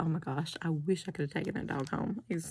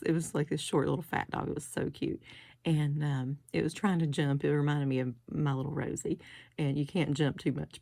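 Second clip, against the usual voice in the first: ordinary talk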